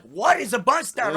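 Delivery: high, loud baffled question; a loud, high mock of a puzzled outsider. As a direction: play the confused out-of-towner, loud and high. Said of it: "They're like".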